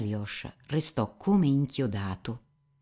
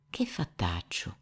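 hushed question; 4 dB quieter than usual. Acted. Alyosha turbato interroga Rakitin. (domanda sussurrata, lenta)

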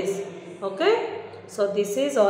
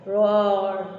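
a mild mock roar; lion roar; 2 semitones lower than usual. Shown as a demonstration. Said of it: "I can roar like a lion".

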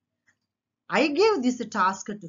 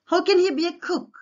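high, quick challenging question; interrogating; 9 semitones higher, 4 dB louder, faster than usual. Acted. King doubts a carpenter can cook, interrogating. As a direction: demand an answer in a raised pitch. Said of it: "What he interrogate అమ్మా?".

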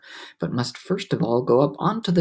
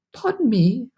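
ordinary delivery

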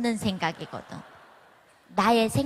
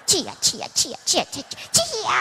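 louder and higher each time up to a shout; hammed-up chant of 주여 growing into a yell; imitating a church lady crying out 주여 ever louder; 8 semitones higher, 5 dB louder than usual. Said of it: "이쪽에 있는 아줌마가".

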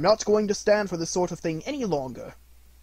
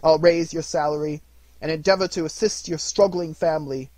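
put-on louder, jovial old man; hearty and jolly; 3 semitones higher, 9 dB louder than usual. A narrator's reading. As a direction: beam with generosity. Scrooge joyfully generous.

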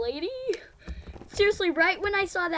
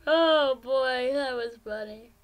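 usual speaking voice

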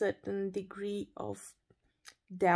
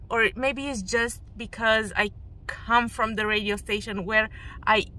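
normal talking voice

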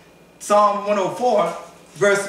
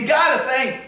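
ordinary talk, nothing marked

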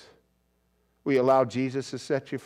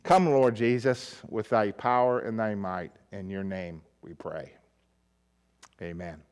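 soft, hushed prayer fading away; closing prayer; 5 semitones lower, 3 dB quieter than usual. Acted. Pastor closing the sermon in prayer, hushed and soft. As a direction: pray quietly to close the message.

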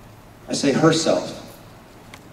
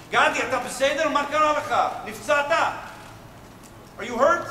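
higher, urgent calling out to a fallen soldier; worried call; 11 semitones higher, much faster than usual. Retold or imitated. Commander running down the stairs to Gadi, alarmed and calling. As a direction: call down in alarm, checking he's alive.

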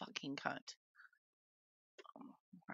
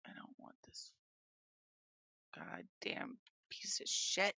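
muttered curse; 11 semitones lower, 6 dB quieter than usual. Real, not acted. Cursing at the computer.

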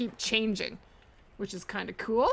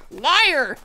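playful mock outburst, much louder; joking accusation; 2 semitones higher, 9 dB louder than usual. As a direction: tease the viewer with a fake accusation. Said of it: "I'm just kidding".

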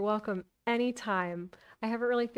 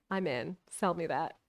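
ordinary delivery

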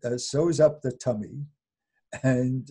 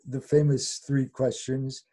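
ordinary talk

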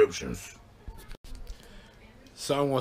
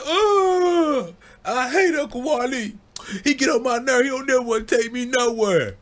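put-on whiny kid brother; 12 semitones higher than usual. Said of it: "He's like".

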